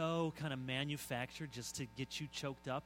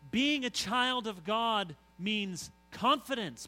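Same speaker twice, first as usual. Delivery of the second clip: Being drawn out slowly, high and loud; slow down and hammer Being; emphatic preaching; 7 semitones higher, 10 dB louder, slower than usual. Real, not acted. Preacher repeats key phrase emphatically.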